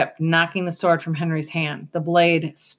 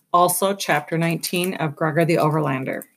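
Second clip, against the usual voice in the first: plain reading voice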